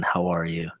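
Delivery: hushed, low golf announcer; golf commentator. Pretend. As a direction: whisper it like a golf broadcast. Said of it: "in a golf commentator's voice".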